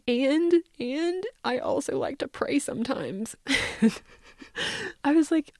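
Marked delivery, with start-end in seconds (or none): 0.1-3.3 a higher, whispered admission; timid whisper. Retold or imitated. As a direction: confess it as a shy child.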